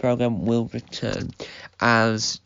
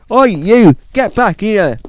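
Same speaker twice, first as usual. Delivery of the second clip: loud, high police yell; shouted command; 8 semitones higher, 11 dB louder than usual. Acted. Policeman calling after a bank robber, loud high shout. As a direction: yell to stop someone running away.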